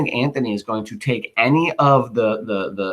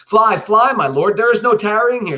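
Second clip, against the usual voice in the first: much higher, louder warning cry; urgent alarm; 11 semitones higher, 5 dB louder than usual. Acted. Soldier urging Brutus to flee the alarm, loud. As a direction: shout at him to flee now.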